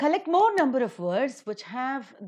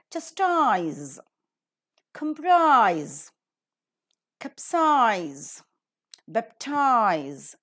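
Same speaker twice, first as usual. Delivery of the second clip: chastise, comprise, capsize, baptize with a strong final syllable; chas-TISE, com-PRISE, cap-SIZE, bap-TIZE, each set apart; last syllable accented; much slower than usual. Shown as a demonstration. Teacher models pronunciation of chastise, comprise, capsize, baptize, last syllable accented. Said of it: "the last syllable is accented".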